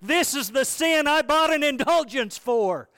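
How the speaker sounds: high, loud mocking shout; yelling back. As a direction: taunt him as you ride away. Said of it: "he yelled back at him".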